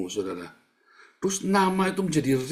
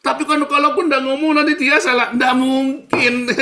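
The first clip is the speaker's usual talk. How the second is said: mock objector; 12 semitones higher, 7 dB louder than usual. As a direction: parrot the doubter in a squeaky raised voice. Menirukan orang yang membantah. (tiruan membantah dengan suara tinggi dan keras)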